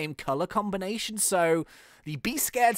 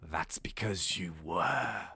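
villain voice; 11 semitones lower, 7 dB quieter, slower than usual. Acted. Villain speaks, low voice.

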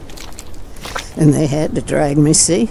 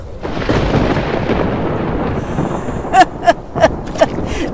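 mocking laugh; 14 semitones higher than usual. Mocking the storm.

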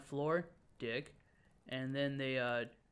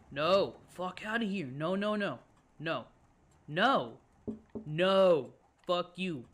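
raised, loud bursts of "no"; loud, high, defiant protest; alarmed refusal; 4 semitones higher, 7 dB louder, slower than usual. Real, not acted. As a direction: shout the threat away. Refuses a scare in the game.

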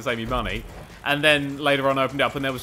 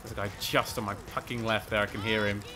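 ordinary delivery

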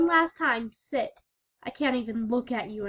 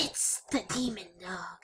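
whisper; 6 semitones lower, 9 dB quieter than usual. Acted. Scared hushed warning.

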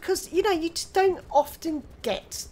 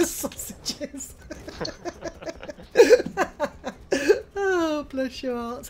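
laughing; 6 semitones higher, 6 dB louder, much slower than usual. Real, not acted. Laughing at friend's greeting.

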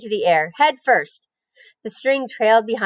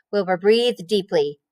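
plain reading voice

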